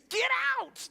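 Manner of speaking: loud, high exasperated shout; hammed-up angry yell; mock shout. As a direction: bark them out of the room in jest. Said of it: "That's like my response".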